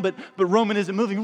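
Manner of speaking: ordinary talk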